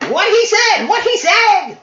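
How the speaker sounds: shrill, very high and loud squawk; ear-splitting goblin shriek; screeching goblin. Acted. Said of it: "screeching out in that shrill voice".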